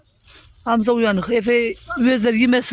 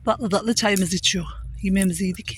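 vlog narration; 3 semitones lower, 5 dB quieter than usual. Acted. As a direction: wrap up the video gently. Vlog closing narration to camera.

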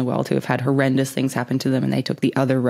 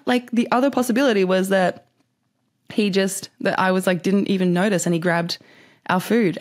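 usual speaking voice

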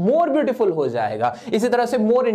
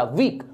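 वीक in one falling flow, lower; one-syllable demo; 6 semitones lower, much slower than usual. Shown as a demonstration. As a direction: let वीक drop out as one sound. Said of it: "एक flow में निकला".

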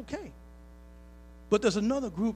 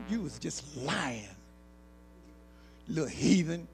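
put-on teasing old lady; 2 semitones lower, 7 dB quieter, slower than usual. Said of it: "that little lady said".

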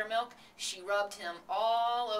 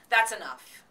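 loud, abrupt interruption; excited raised outburst; crying out; 4 semitones higher, 11 dB louder than usual. A narrator's reading. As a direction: cut in loudly to stop the list. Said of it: "cried Temple".

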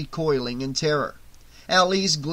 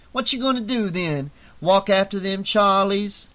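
higher-pitched, needling question; challenging gang woman; 5 semitones higher than usual. A narrator's reading. Gang woman to Ali about going after them, challenging. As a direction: challenge the boss with street sass.

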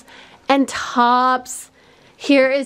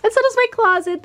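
excited gush; 11 semitones higher, faster than usual. Real, not acted. Gushing over the new closet.

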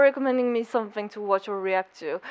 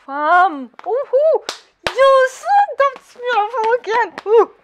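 loud, high whooping excitement; hyped; 9 semitones higher, 6 dB louder than usual. Real, not acted. Fan cheering beautiful animation in an anime, hyped. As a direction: cheer it out, thrilled. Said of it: "I'm so hyped!".

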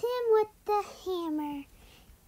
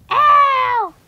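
loud, higher yelp of pain; mock cry of pain; 4 semitones higher, 11 dB louder than usual. Thrown against the floor, the angel yelps. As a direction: yelp as you hit the floor.

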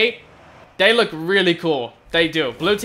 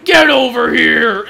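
Scorpion voice; 4 semitones higher, 6 dB louder, slower than usual. Pretend. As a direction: bellow it like a fighting game ninja. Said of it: "I sound like Scorpion".

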